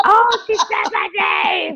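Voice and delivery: high, drawn-out starstruck squeal; fangirl squeal. Acted. Fan hearing Oprah say their name live, hammed-up fangirl squeal. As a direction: gush slowly like an overwhelmed fan. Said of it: "fangirl moment".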